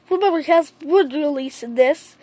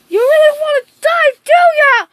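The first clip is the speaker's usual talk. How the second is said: high, loud acted challenge; threatening taunt; 8 semitones higher, 6 dB louder than usual. Naruto challenging his pursuers, taunting threat. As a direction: throw it out fast and loud, daring them.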